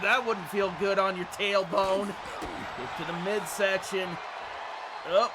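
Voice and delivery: loud, high excited play-by-play; wrestling commentator. Acted. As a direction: call the big moves like a ringside announcer.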